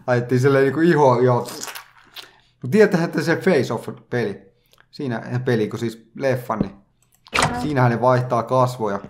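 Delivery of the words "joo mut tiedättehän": usual speaking voice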